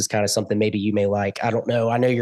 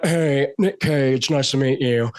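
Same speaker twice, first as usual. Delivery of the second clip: slow, drawn-out star introduction; imitated movie-star drawl; Nicolas Cage; 3 semitones higher, slower than usual. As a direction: stretch it out in an eccentric drawl. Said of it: "he's like".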